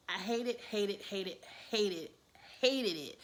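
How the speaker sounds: hated hammered over and over; repeated hated. Real, not acted. Host venting about Memphis, each hated stressed. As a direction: hit each hated hard. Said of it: "I hated the way".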